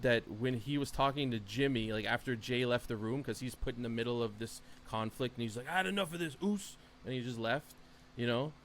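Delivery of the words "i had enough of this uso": fed-up outburst, pitched high; higher-pitched mock exasperation; imitating Jey Uso. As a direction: act out someone who has had enough, voice climbing. Quoting Jey storming out of room.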